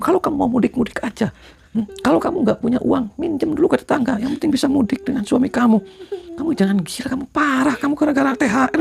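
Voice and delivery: shrill-pitched lecture to the wife about mudik money; high scolding. Acted. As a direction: scold her like a fed-up elder.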